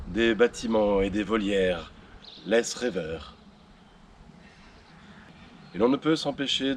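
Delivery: hushed grandiose history voiceover; documentary narration. Acted.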